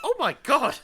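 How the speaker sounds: high, loud mock disbelief; hammed-up exasperation; exasperated. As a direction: roll your eyes at it out loud. Her own exasperated reaction.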